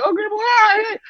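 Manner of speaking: high, sheepish innocent voice. Retold or imitated. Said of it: "I'm like".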